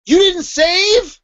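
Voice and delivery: high, very slow incredulous question; mock disbelief. Someone learning the writer didn't save, mock horrified. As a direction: stretch it out high, as if appalled.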